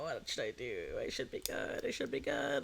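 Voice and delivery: very soft, half-voiced muttering; talking to self. Real, not acted. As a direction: murmur it while weighing a decision. Debating with self about food.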